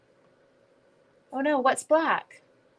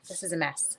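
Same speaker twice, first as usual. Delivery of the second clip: usual speaking voice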